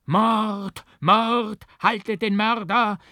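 high, loud cries of murder; shouting crowd. A narrator's reading. Voices in the crowd chase the murderer, loud high shouts. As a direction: yell after the fleeing killer. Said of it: "riefen Stimmen hinter mir her".

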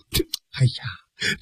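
breathy, voiceless exasperated sigh. Acted. Zhan Zhao to Xu Qing, an exasperated voiceless sigh. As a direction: whisper it out slowly, at a loss.

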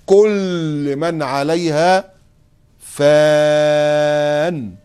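very slow, stretched فان held long; Quran recitation. Acted. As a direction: intone the verse, holding فان. (تلاوة ممدودة لكلمة فان)

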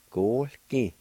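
normal talking voice

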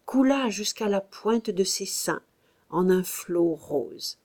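ordinary delivery, nothing marked

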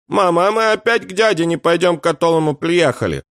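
put-on very high small-child voice; high, plaintive child's question; whining little boy. A narrator's reading. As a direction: whine at mum like a little kid. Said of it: "заныл мальчик".

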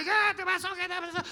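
high-pitched angry rant; hammed-up furious driver, pitch shoved up; mock confrontation. Driver out at the traffic light confronting another, mock fury.

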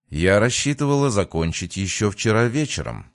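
ordinary delivery, nothing marked

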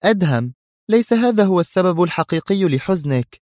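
high, puzzled woman's voice. A narrator's reading. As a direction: probe him in bewilderment. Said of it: "سألته في حيرة".

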